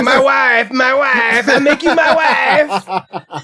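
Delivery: a hammed-up Borat voice, slow at the end; Borat impression. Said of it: "he's like".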